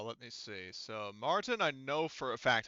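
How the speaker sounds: normal talking voice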